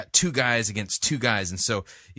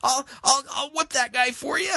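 very high falsetto plea; mock a desperate God; whiny begging God. Critic mocking God as a tag-team partner. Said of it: "And he says".

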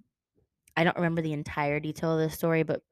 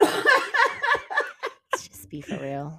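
laughing; 11 semitones higher, 4 dB louder, much slower than usual. Real, not acted. Laughing while speaking.

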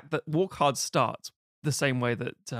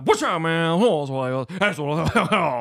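slurred, drawn-out nonsense drawl; hammed-up incoherent rock-star impression; rambling star; 4 dB louder, slower than usual. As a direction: smear the words into gibberish. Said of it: "he keeps rambling".

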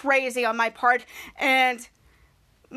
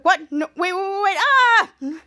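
louder, rising pleas ending in a slow cry; panicked cry; 4 semitones higher, 5 dB louder, slower than usual. Retold or imitated. Kid losing his superpowers, panicked slow cry. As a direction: beg then cry out.

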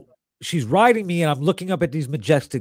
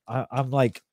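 usual speaking voice

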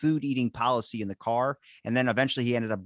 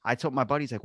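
ordinary delivery

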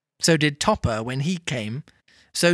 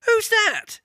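very high-pitched startled question; startled Fred. A narrator's reading. Fred calls to the unexpected visitor, startled. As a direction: call out in high-pitched surprise.

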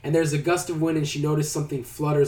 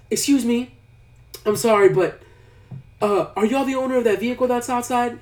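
higher-pitched, hesitant polite request; retold polite waitress; 8 semitones higher, 3 dB louder than usual. Waitress asking diners about the car outside, tentatively polite. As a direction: imitate a waitress gently cutting in.

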